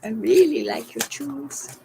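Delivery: light imitation of a comic catchphrase; Cheech Marin. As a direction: tease like a stoner comedy line. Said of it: "I used to do that to people".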